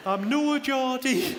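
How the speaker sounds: high, drawn-out bad Sunderland accent; terrible Geordie accent. Barmaid from Sunderland repeats her denial, exaggerated bad Geordie accent. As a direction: stretch it out in a hammed-up north-east English accent. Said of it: "Sorry, that's a terrible accent".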